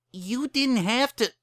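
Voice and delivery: very high, slow plea. Acted. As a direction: say it gently, pleading. Bon Bon pleads.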